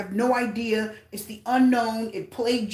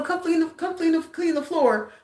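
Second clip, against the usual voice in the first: higher voice calling for the cleaner; voicing the managers; 6 semitones higher than usual. Store staff calling the cleaner, slightly higher. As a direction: call him over a touch high. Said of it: "they would come".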